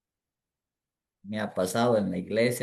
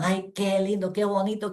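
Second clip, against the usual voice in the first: gushing mock praise; 7 semitones higher than usual. Mocking praise-seekers.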